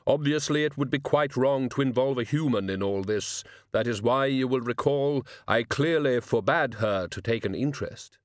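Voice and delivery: measured, formal voice of Death; self-justifying Death. Acted. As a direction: lay out the excuse with gravity.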